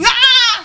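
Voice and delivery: loud, high mock baby cry; hammed-up baby crying; imitated baby wail. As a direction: burst into a high-pitched infant wail. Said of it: "start crying".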